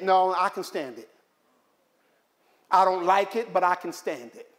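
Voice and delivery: ordinary talk, nothing marked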